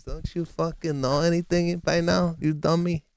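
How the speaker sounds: imitating dad, high-pitched; scolding father. Father berating son about dialect coach, scolding. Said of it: "He goes".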